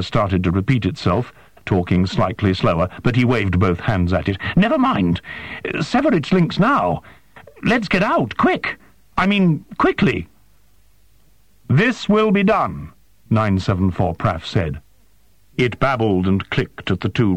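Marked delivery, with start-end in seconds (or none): 4.6-10.3 panicked urging. A narrator's reading. Yuejin urges flight in panic. 11.7-12.9 higher, stiff and formal alien voice; alien interpreter. A narrator's reading. Interpreter questions the human, high and formal.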